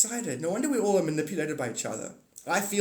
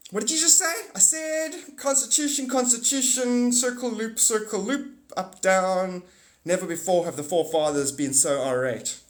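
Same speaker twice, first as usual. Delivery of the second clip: much higher, louder hammed-up ranting; frantic parody, high and loud; mock bombast; 6 semitones higher, 4 dB louder than usual. Mock rant, circle loop.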